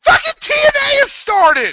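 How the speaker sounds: screaming high and loud at friends; real angry high yell; furious shout. Real, not acted. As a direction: yell them out of the call. Yelling at friends in call.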